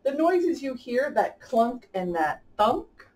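lively rhyming storybook read-aloud; reading to children. A narrator's reading. Host reading a rhyming picture book to kids, lively storybook voice. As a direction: bounce the rhymes along for young listeners.

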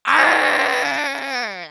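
very loud, drawn-out pirate "arr"; pirate growl. Pretend. Joker showing a friend what a pirate says, loud pirate cry. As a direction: belt out a long pirate cry. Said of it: "Do you know what the pirate says?".

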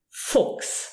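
Fuchs said as "fooks"; K sound. Shown as a demonstration. Teacher showing learners CH before S, Fuchs with KS. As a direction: chs in Fuchs pronounced KS. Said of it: "you pronounce it K".

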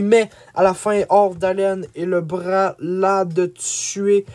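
plain reading voice

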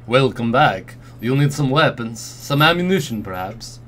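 put-on hammy Dracula-style accent. Arms dealer offers weapons, Dracula accent. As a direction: lilt up then drop away in a Dracula accent. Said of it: "oh, Dracula accent".

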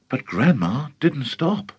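ordinary delivery

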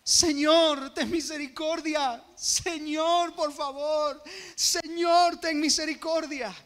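high, loud begging cries to the Lord; a mother shouting for mercy behind the crowd; desperate pleading cry. Retold or imitated. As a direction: cry out for mercy over and over, voice straining. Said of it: "una continua rogativa en alta voz".

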